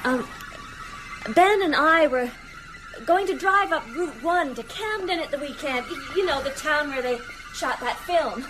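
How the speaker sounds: high-pitched, made-up small talk; forced nervous chatter. Acted. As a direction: keep talking to cover your fear. Stay here and talk.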